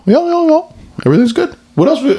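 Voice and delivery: very high, squeaky puppet voice; shrill little Muppet chatter. Pretend. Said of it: "Meeper from Muppets".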